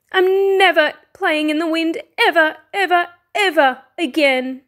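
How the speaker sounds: high, emphatic sulk; petulant vow. A narrator's reading. Fox home after the wind spoilt everything, petulant. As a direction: hammer each repeated word crossly.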